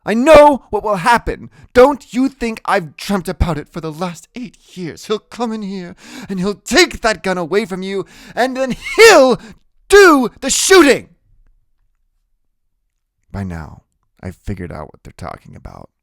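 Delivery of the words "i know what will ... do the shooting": loud, high-pitched pleading; high, impassioned warning; distraught grandmother. A narrator's reading. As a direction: argue at full voice, building to a shout.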